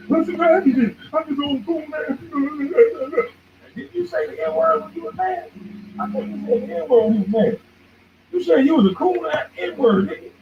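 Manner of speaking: high-pitched mocking imitation of the YouTube boy. Mocking a YouTuber he calls feminine, in a high voice. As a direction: squeak it out to ridicule him.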